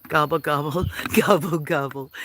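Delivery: playful mock turkey gobbling, laughing; turkey gobble. Greeting Turkey with turkey noise.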